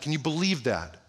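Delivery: ordinary talk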